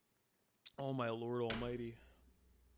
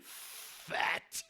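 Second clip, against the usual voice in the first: disgusted "fat". Retold or imitated. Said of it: "The way he said fat".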